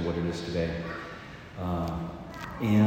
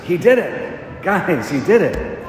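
high, loud, slow cry of joy; excited cry; 12 semitones higher, 10 dB louder, slower than usual. Retold or imitated. Healed student shouting to his dorm mates, amazed and thrilled. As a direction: shout the miracle to the whole dorm.

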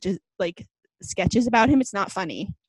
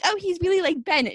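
mocking fans; 7 semitones higher than usual. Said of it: "stop being like".

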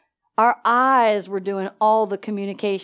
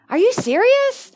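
stunned disbelief; 13 semitones higher, 4 dB louder than usual. Retold or imitated. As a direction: pitch leaps up high on serious, astonished. Daughter asks for McDonald's.

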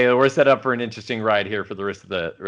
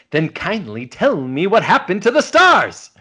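raised, louder mock-indignant demand; Kirk, exasperated demand; 7 semitones higher, 5 dB louder than usual. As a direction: demand an answer, pitch climbing high. Acts Kirk's exasperated demand to Spock.

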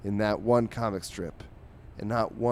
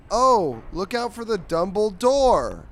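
high, loud, drawn-out cartoon alarm; mock warning; 11 semitones higher, 8 dB louder, slower than usual. Cat warns dog of the pun, mock alarm. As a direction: call out a hammed-up warning.

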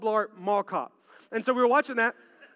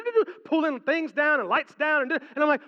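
ordinary talk, nothing marked

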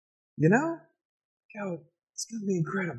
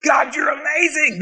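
very high, disbelieving shout; hammed-up squeal of astonishment; overwhelmed amazement; 13 semitones higher, slower than usual. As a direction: cry out in acted, blown-away awe. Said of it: "and going".

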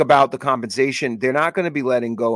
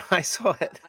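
laughing; 6 semitones higher, 5 dB quieter than usual. Real, not acted. Co-host laughs at the praise.

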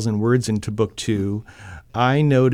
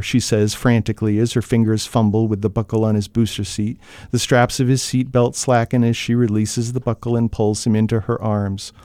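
steady, measured reading of a tense scene; even storyteller voice over a car crash; story narration; 3 semitones lower than usual. A narrator's reading. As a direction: read it like an audiobook narrator drawing listeners in.

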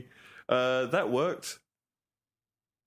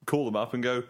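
ordinary delivery

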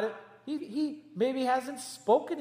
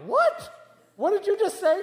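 high, loud incredulous what; shocked disbelief; 12 semitones higher, 6 dB louder than usual. Retold or imitated. Family reacting in shock to the niece's new word. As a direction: gasp out the question in disbelief. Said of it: "We're like".

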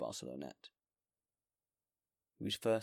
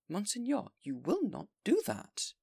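high, louder, slow plea; anxious pleading mayor; 12 semitones higher, 7 dB louder than usual. Acted. Mayor pleading with the bishop, high and loud. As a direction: beg him to stay, voice climbing.

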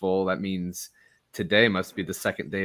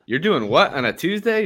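confused disbelief; 10 semitones higher, 8 dB louder than usual. Retold or imitated. Acting out own confused question.

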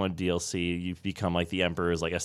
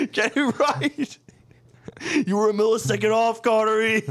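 laughing high mock rant; 13 semitones higher, 3 dB louder than usual.

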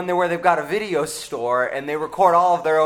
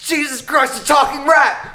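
much higher, loud startled yell; shocked shout; 10 semitones higher, 7 dB louder than usual. Acted. Cop yells in shock at the talking rat. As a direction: yell in disbelief.

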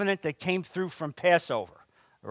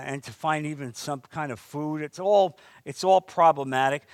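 ordinary talk, nothing marked

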